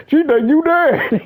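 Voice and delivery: put-on squeaky little boy pleading; high-pitched child talking to his dad; high kid voice. Little boy pleading with Pop, high squeaky voice.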